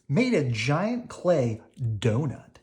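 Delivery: ordinary delivery, nothing marked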